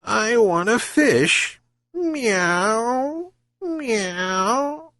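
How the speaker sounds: high, slow, softening cat voice; purring. Acted. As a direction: purr it out like a spoiled cat. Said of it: "she purred again".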